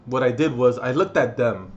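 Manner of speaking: usual speaking voice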